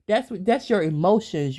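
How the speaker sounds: ordinary talk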